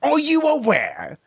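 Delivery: high mock British debater voice; hammed-up posh British insult; British accent. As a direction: parody a smug House of Commons speaker. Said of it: "training for the House of Commons".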